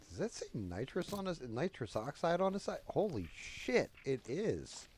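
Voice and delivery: astonished discovery, voice lifting high; amazed buddy. Retold or imitated. Buddy spotting a tank of nitrous, amazed.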